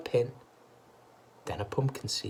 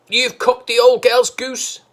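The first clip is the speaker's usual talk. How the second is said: high, loud, slow scolding; glaring scold; 14 semitones higher, 14 dB louder than usual. A narrator's reading. Missus Cranky scolding her husband, high, loud and slow. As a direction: scold him hard and slow. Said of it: "Glaring at her husband".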